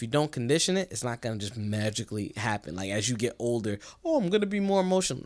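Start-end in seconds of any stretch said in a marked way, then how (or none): 4.0-5.2 higher, quick put-on voice; mock naive realization. Host mocking the idea that change happens magically, mock naive. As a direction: toss it off fast and high, imitating someone naive. Mocks idea of emotion arriving by itself.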